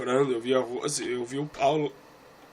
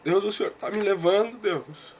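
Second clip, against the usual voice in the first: high and louder, rising anguished plea; louder desperate plea; 8 semitones higher, 4 dB louder than usual. Retold or imitated. Preacher crying out to God in the vision, louder anguished plea. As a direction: cry out to God, pushing harder.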